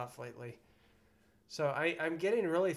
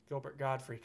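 normal talking voice

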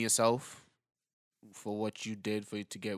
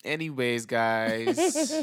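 sign-off flourish; 2 semitones higher, 8 dB louder, much slower than usual. Real, not acted. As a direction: wrap the show up with a flourish. Signing off loudly.

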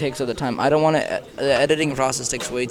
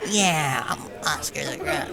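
Oscar the Grouch voice; 3 semitones higher, slower than usual. Acted. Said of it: "What was that, dude?".

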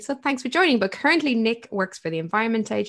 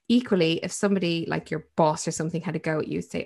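normal talking voice

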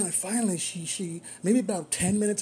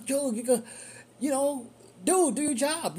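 urging; 8 semitones higher than usual. Retold or imitated. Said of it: "I was like".

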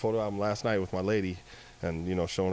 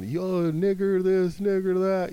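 mocking kids; 10 semitones higher, 4 dB louder, slower than usual. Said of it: "I was tired of them".

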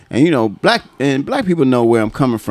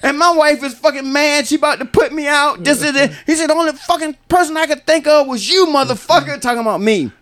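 excited, very high-pitched storyteller voice; coworker retelling a row. Retold or imitated. Coworker recounting his wife's magazine find, high-pitched indignant retelling. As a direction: act out both spouses, pitched way up.

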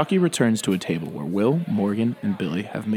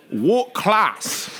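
British woman voice; 10 semitones higher than usual. Acted. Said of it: "You said she was British".

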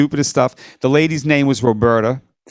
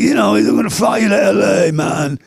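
put-on British accent; 7 semitones higher than usual. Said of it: "And he's like".

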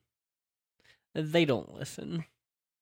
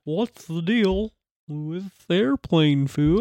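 slow, louder standup-comic delivery; Seinfeld standup bit; 5 semitones higher, 5 dB louder, slower than usual. Retold or imitated. Quoting Seinfeld to tease friends, hammed-up standup voice. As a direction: ham up a Seinfeld joke. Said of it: "Seinfeld reference".

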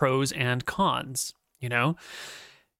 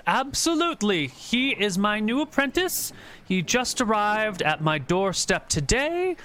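put-on higher, smooth wizard covering for his boy; pointed wizard; 7 semitones higher than usual. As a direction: say it pointedly, vouching for him. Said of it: "he says, looking at you pointedly".

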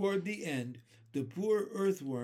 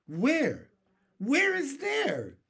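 high, loud, drawn-out demand; insistent questioning; 10 semitones higher, 7 dB louder, slower than usual. A narrator's reading. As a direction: press for an answer, pitched way up. Companions demand to know where.

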